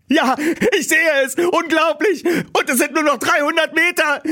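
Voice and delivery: high, breathless excitement; thrilled at the finish; excited. Acted. Justus answering Bob at the race, high excitement.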